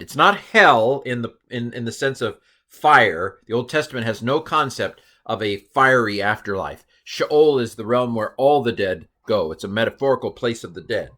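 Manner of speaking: usual speaking voice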